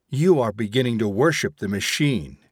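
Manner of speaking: usual speaking voice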